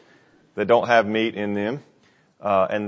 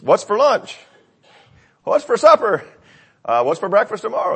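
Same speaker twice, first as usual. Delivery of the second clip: mock whiny complaint; 8 semitones higher than usual. Mocking Israelites' repeated complaint.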